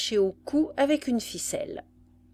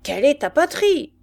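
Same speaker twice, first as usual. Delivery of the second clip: louder, higher demand; insistent repeat; 6 semitones higher, 9 dB louder than usual. A narrator's reading. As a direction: shout the question to force an answer. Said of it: "Le sergent répéta". (question répétée avec insistance)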